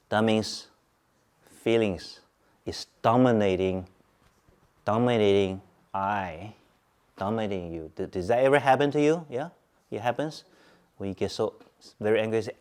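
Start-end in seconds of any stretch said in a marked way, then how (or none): none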